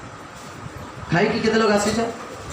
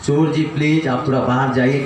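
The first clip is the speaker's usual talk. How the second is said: lower, meek, overly polite begging; mock polite plea; 7 semitones lower than usual. Homeowner politely begging a thief to leave, mock meek. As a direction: beg the thief nicely, a little lower. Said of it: "और आप बोल रहे हैं".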